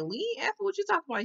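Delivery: high-pitched mock protest; imitating someone caught off guard; indignant denial. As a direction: act out a baffled, high-voiced denial. Acted denial of an accusation.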